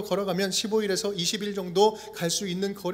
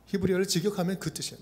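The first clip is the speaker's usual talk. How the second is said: normal talking voice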